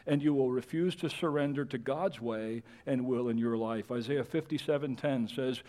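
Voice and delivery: ordinary talk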